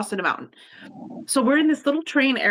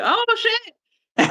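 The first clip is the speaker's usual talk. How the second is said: surprised; 12 semitones higher than usual. Retold or imitated. Shocked by wife's girlfriend claim.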